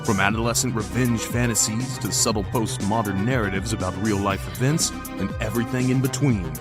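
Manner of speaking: ordinary delivery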